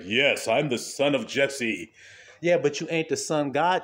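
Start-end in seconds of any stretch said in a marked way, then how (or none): 0.0-1.9 a hint of a proud, booming brother, slow; slow, self-important, lightly booming; lightly voiced proud brother announcing himself; booming bass voice. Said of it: "big-ass booming bass voice".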